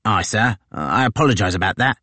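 a gloating sergeant pleased with himself; brash marine sergeant. Acted. Tozer to his captain, brash and gloating.

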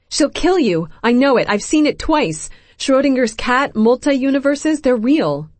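high, loud, breathless insistence; frantic. A narrator's reading. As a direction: pour out the warning in a panic. Amy frantically warning Loki.

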